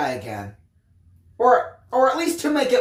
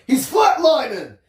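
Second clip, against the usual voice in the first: grunted; 6 semitones higher, 5 dB louder than usual. Acted. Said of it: "grunted one of the orderlies".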